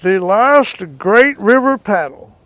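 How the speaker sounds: slow, loud announcer-style slogan; grand slogan. Real, not acted. To the interviewer, proudly announcing his logo, slow and loud. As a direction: boom it out slowly like a title. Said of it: "announcing his slogan grandly".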